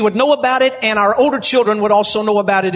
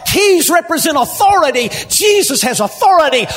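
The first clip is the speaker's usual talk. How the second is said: full-force preaching; 6 semitones higher than usual. Acted. Preacher proclaiming Christ's authority to the congregation, shouting. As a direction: build to a roaring proclamation.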